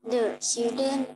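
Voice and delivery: usual speaking voice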